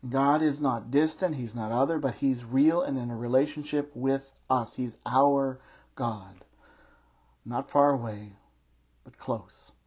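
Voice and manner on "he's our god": "our" stretched out slowly; slow down and dwell on "our"; stress on OUR. Real, not acted. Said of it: "He emphasizes the word "our".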